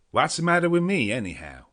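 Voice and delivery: loud, high, breezy American call; lightly voiced American frontiersman; Quincey Morris. A narrator's reading. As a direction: cut in cheerfully from across the room. Quincey speaks from the sofa.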